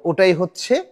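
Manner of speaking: ordinary delivery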